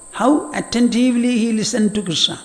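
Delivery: ordinary talk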